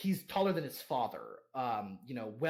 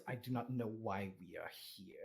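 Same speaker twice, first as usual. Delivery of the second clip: sulky low Patrick; 6 semitones lower, 10 dB quieter than usual. Acted. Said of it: "It goes".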